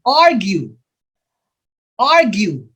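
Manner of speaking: AR stressed in "argue", falling away after it; first-syllable stress. Shown as a demonstration. Teacher sounding out option C, "argue" stressed on first syllable. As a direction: say "argue" as AR-gue. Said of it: "first syllable".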